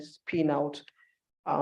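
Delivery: ordinary talk, nothing marked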